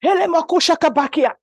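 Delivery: wife shouting tongues in the living room; frantic shout. Retold or imitated. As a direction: shout it out in a spiritual frenzy. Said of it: "I'm like".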